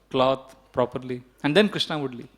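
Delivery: ordinary delivery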